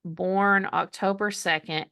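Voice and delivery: usual speaking voice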